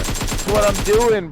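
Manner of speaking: emphatic commentator voice. Acted. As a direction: announce it like a caster. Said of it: "sports commentator".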